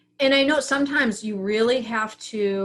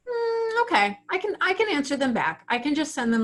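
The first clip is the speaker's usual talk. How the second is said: light, agreeable; 5 semitones higher than usual. Retold or imitated. Calm after sleeping on it.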